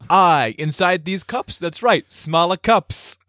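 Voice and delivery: slow, high comic mover; Brooklyn mover. Acted. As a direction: proudly reveal the absurd label, drawn out. Mover bit, explaining nested cups.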